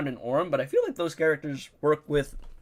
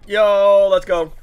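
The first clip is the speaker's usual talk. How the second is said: loud cheer; 6 semitones higher, 11 dB louder, much slower than usual. Real, not acted. Cheering a big hit.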